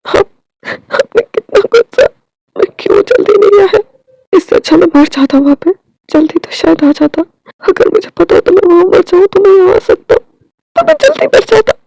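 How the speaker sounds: rising to a high, breaking wail; wailing that he should have died sooner; anguished. Acted. Anguished guilt over arriving late.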